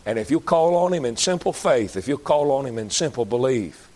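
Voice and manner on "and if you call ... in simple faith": ordinary talk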